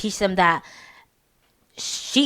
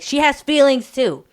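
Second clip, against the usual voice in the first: higher, louder imitated reproach; protective dad reproach; 7 semitones higher, 5 dB louder than usual. Father defending mom to the kids, imitated reproach. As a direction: stand up for her, acting the dad.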